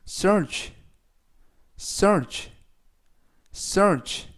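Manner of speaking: "search" said as a separate word, ending in a crisp ch; teacher's drill of "search", evenly repeated; pronunciation model. Shown as a demonstration. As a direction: model "search" clearly with a falling tune. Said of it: "라고 발음하시면 되겠고요".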